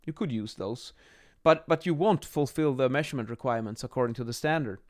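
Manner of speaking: normal talking voice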